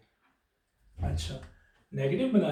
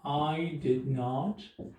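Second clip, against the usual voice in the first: very slow, word by word; drawn-out teacher dictation; slow and spelled out; 3 dB quieter, much slower than usual. Shown as a demonstration. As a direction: dictate each word slowly. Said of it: "और आप लगाएंगे did not".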